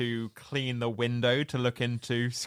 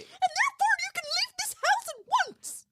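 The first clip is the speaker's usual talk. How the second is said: very high squealing diva; Miss Piggy voice. Retold or imitated. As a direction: spit it back like a furious pig diva. Piggy line in exchange.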